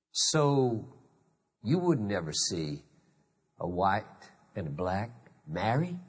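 slow, soft imitated Southern drawl of an old relative. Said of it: "she's in her southern drawl".

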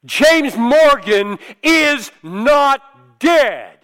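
loud, slow, high booming proclamation. Retold or imitated. Ethics teacher proclaiming at the funeral, booming and slow. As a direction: boom it out over the coffin. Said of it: "in his booming voice said".

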